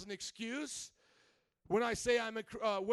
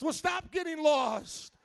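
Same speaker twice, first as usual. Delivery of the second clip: usual speaking voice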